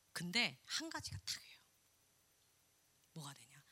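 breathy confiding hush; whisper. Real, not acted. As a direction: drop to a secret whisper.

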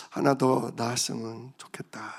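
a soft breathy whisper, as if confessing a secret wish. Real, not acted. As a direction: whisper it to yourself. Confiding wish to congregation. (속삭이듯)

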